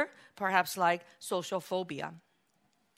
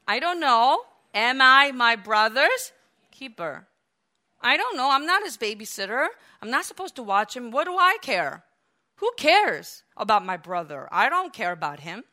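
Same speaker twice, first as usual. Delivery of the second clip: defiant denial; 4 semitones higher, 8 dB louder than usual. Retold or imitated. Cain's defiant answer to God.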